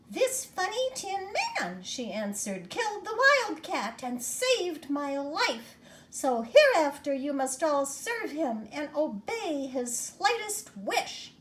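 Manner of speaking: very high, squeaky tiny voice, slowed down; squeaky little mouse. Acted. Mouse queen gives orders.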